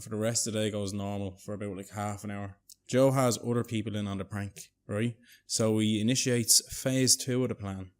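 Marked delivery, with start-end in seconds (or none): none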